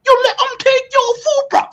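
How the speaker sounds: very high, mock voice of people; mocking squeaky voice. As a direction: squeak it out like a cartoon mocking them. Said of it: "you hear these people talk".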